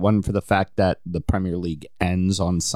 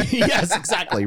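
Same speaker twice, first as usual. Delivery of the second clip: laughing; 12 semitones higher than usual. Real, not acted. Laughing along at the joke.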